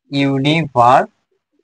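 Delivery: ইউনিভার্সিটি drawn out very slowly, syllables audible; slow example word. Shown as a demonstration. Teacher saying ইউনিভার্সিটি slowly as an example. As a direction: stretch ইউনিভার্সিটি so each syllable stands apart. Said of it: "example word said for syllable split".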